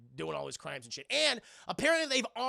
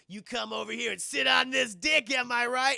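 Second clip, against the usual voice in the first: loud, higher leering taunt; cocky catcall; 5 semitones higher, 8 dB louder than usual. Retold or imitated. Sleazy racer yelling a come-on at Letty, loud and cocky. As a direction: yell it like a sleazy street racer showing off.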